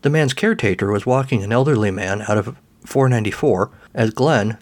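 usual speaking voice

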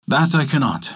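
plain reading voice

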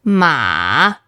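Ma dipping very low; "Ma" long, low and dipping; third tone on Ma. Shown as a demonstration. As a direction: drop "Ma" to the bottom of your voice, then lift slightly. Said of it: "that means horse".

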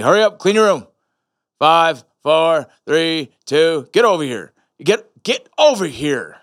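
loud, punchy military orders; drill sergeant dad. Retold or imitated. Military dad ordering his kids to clean up, drill-sergeant style. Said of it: "military almost style".

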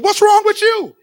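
high, loud mock-exasperated wife; wife teasing her husband, loud and high; wife's exasperated question. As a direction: toss it off like a fed-up wife. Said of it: "my Barbara said".

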